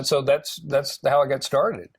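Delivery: ordinary talk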